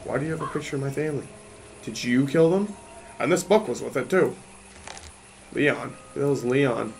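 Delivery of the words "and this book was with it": usual speaking voice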